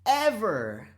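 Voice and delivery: loud, high-pitched, punchy warning; forceful, exaggerated cautioning; emphatic scolding. Real, not acted. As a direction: drive every word home like a stern lecture. Mock-stern warning to viewers.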